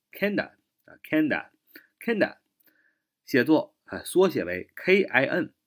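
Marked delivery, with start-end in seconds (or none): 0.1-2.4 "kinda" as one word ending in "duh"; usual reduced form. Shown as a demonstration. Teacher drills what natives usually say, reduced "kinda". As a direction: slowly repeat "kinda" with the of reduced. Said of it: "那他们一般都读作".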